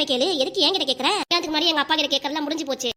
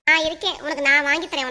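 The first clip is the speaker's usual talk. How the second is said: normal talking voice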